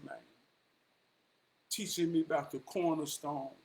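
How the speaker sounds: usual speaking voice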